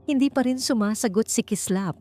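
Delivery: ordinary delivery, nothing marked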